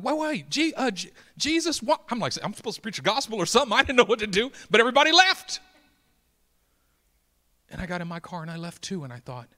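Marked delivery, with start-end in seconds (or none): 0.0-5.6 high, loud storytelling; loud preaching story. Acted. Preacher reliving the crash for the church, loud. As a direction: tell it at full pulpit force. Retelling panic at full force.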